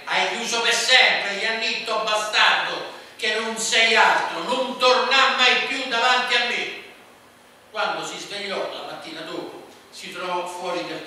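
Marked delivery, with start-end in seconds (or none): 0.0-6.8 shouting. A narrator's reading. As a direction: bellow the banishment. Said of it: "sentì la madre gridare".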